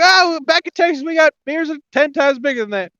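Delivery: high-pitched tourist brag; boasting Texan. Retold or imitated.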